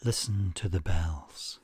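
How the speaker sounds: unhurried guided-relaxation delivery; guided meditation. Acted. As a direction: lead listeners calmly, phrase by phrase, leaving space after each.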